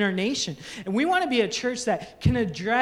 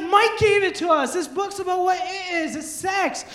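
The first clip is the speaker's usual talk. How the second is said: put-on excited kids; 9 semitones higher than usual. Kids answer sister.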